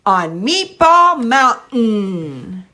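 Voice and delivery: slow, loud, stretched, stress on Meatball; slow modeled phrase. Shown as a demonstration. Therapist modeling the phrase for the child to echo. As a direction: model "On Meatball Mountain" slowly with a rise on Meatball. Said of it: "I'm varying my prosody".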